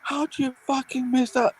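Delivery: high disbelieving yell; berating a player for a miss; mock fury; outraged fan. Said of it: "And I'm like".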